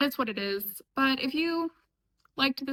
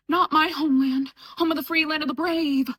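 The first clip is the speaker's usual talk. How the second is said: louder, hammed-up patriotic protest; mock-outraged American; 3 semitones higher, 5 dB louder than usual. American answering British criticism, mock patriotic outrage. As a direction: declaim it like an offended patriot. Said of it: "every American will be like".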